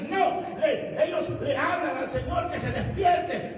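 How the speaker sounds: high, driving, emphatic sermon delivery; preaching. Acted. Preacher explaining why the disciples wake the Lord, forceful preaching. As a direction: hammer the point home to the congregation.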